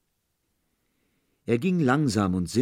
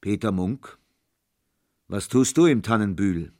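deep booming voice; 4 dB louder than usual. A narrator's reading. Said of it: "mit tiefer, dröhnender Stimme".

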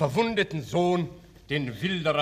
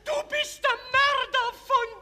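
shouted accusation; 5 dB louder, slower than usual. Acted.

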